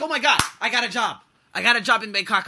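thrilled about a job in Bangkok, mock excited; retold excitement. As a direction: gush like you just got the job. Retold joy at job offer.

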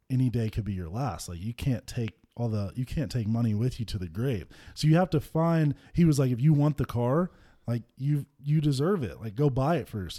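normal talking voice

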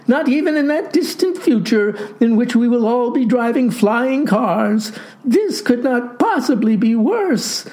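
high-pitched, anguished lad; crying out. A narrator's reading. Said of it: "cried the lad".

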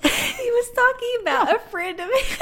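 high, squeaky laughing. Real, not acted. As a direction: giggle out the awkward reveal. Embarrassed reveal, laughing.

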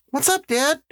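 put-on very high child's voice; young boy. A narrator's reading. Son asking his dad what is up, boyish. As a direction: ask as a little son. Narrator voices the other twin.

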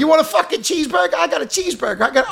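a hint of a jittery crackhead. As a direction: rattle it off like a street hustler. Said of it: "You sound like a crackhead".

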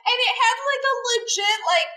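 high voice cracking with laughter; laughing. Real, not acted. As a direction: squeak through the giggles. Laughing description of plane.

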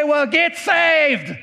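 high, drawn-out punchline; Doctor Phil's reply. Acted. Doctor Phil answering the panicked staff, drawn-out mock advice. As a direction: stretch the words out emphatically.

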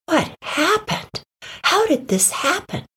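high, alarmed questioning; dismayed disbelief. Acted. Dogs find a coyote in the forest, dismayed. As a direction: ask high-pitched, stretching the first question in disbelief.

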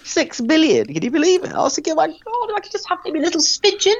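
dreaming of a smidgen of six billion, giddy; giddy excitement. Real, not acted. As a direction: squeal at the fortune. Gushing over six billion pounds.

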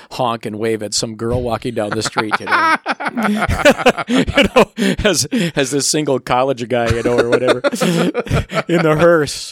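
laughing hard while telling. Real, not acted. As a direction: laughs through the words. Laughing retelling of hearse story.

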